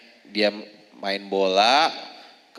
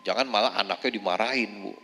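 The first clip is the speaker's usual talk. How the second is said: ordinary talk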